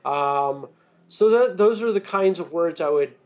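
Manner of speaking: ordinary delivery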